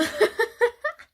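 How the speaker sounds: squealing high-pitched giggle. Real, not acted. Vlogger giggling at her own brag, high squeal.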